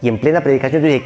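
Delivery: ordinary delivery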